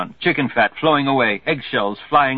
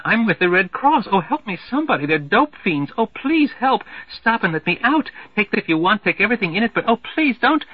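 rising alarm; 8 semitones higher than usual. Acted. Red Cross girl panicking at the kidnappers, rising to frantic pleading. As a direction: panic building, voice climbing ever higher. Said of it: "voice rising to an alarming intensity".

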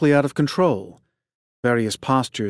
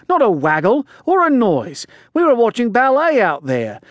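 higher, louder, protesting on a rush; aggrieved coach; 8 semitones higher, 4 dB louder than usual. Acted. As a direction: plead that they were misled.

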